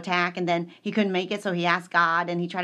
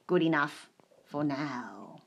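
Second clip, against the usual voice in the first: normal talking voice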